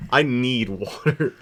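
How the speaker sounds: ordinary delivery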